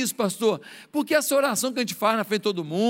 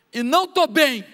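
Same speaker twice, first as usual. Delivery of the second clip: a raised, aching confession; pleading prayer; 4 semitones higher, 5 dB louder than usual. Pretend. Praying alone in his room, a rising plea. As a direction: let the hurt rise as you admit it. Private prayer, pouring out pain.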